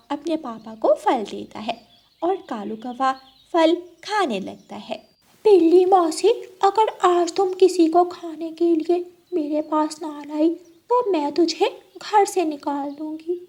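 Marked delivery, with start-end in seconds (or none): 5.5-13.4 a hint of a bossy fox; lightly voiced threatening fox. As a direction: play the fox laying down a threat.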